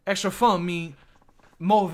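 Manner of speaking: put-on high, loud, consonant-dropping Chinese accent; overdone Chinese accent. Shopkeeper asking for extra funds, overdone Chinese accent. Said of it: "it, it goes too far".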